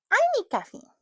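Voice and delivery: put-on high, whiny childish demand. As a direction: whine it like a bratty kid.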